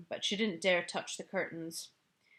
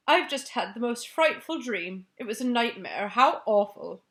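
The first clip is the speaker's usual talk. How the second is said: raised, louder voice of a shaken queen; a queen shaken by a nightmare, pitched up; upset Queen; 3 semitones higher, 7 dB louder than usual. Acted.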